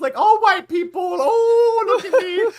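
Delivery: put-on very high, loud, slow caricature in a European accent; a European comic mocking a dumb American. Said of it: "a vague European accent".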